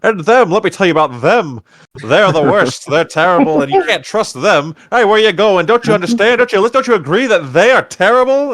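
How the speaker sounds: mock bigot ranting at the room, growing desperate. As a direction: rant like a bigot nobody listens to. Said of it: "he's just like".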